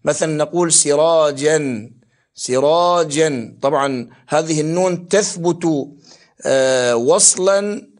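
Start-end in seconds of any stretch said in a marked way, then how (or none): 0.7-3.6 سراجا ending in a clearly sounded "-an"; fatha tanween. Shown as a demonstration. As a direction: say سراجا with a short a and audible final n. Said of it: "تنوين الفتح مثلًا عبارة عن نون ساكنة مفتوح".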